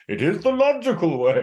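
high, mock-pompous puppet voice; hammed-up puppet declaration; the Eagle from the Muppets. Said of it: "turning into, uh, the Eagle from the Muppets".

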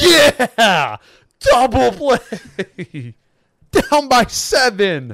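high, loud, slow sarcastic celebration; hammed-up fan whooping; mock cheering. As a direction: drag out a fake cheer for bad news. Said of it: "You think I'm gonna be like".

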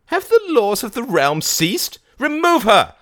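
very high, loud shrill outcry; cried out. A narrator's reading. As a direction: scream the order in outrage. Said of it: "she cried out".

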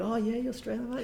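high mock Australian accent, with a hearty "mate". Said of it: "They're like".

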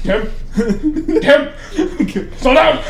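loud, high cries to the driver; acted panic. Frightened passenger begging the driver to slow down, acted panic. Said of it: "I'm scared!".

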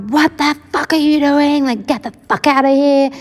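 high, indignant outburst in retelling; retold losing it. Eight-year-old yelling at brother by her bed, retold outburst. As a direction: act out a startled, furious demand to leave. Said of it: "I would literally lose my shit".

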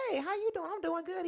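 high, bright-eyed mock cheer; chirpy greeting. Greeting the stranger at Starbucks, high chipper mock cheer. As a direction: play yourself sweetly chipper. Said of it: "And I'm like".

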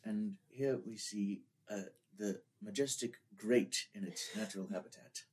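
hushed nature documentary narrator; David Attenborough. Retold or imitated. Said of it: "That was my impression of David Attenborough".

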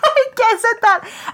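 loud, high squeal through laughter; helpless laughter. Real, not acted. Storyteller admitting a slip to the cohost, cracking up. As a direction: blurt it out while cracking up.

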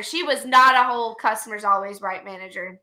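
ordinary talk

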